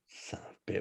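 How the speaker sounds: breathy under-breath curse; whispered curse. Real, not acted. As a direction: hiss it to yourself.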